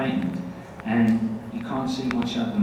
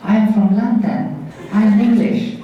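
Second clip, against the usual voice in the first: stiffly practised English, pitched up; practised English accent; 7 semitones higher, 7 dB louder than usual. Retold or imitated. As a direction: overdo a child's careful English accent. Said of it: "I just practiced to do an English accent".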